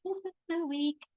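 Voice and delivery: high, put-on tired voice; hammed-up exhausted reply; weary sigh line. Answering a friend, mock worn out and done. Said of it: "Or you're just gonna be like".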